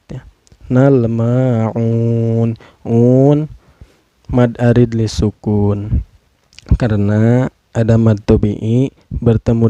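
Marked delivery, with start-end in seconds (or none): 0.7-3.4 "Nal ma'un" chanted with long MA and UN; elongated vowels. Shown as a demonstration. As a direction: stretch the final "un" in a held chant. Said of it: "dipanjangkan boleh dua harakat". ("Nal ma'un" dibaca panjang mengalun)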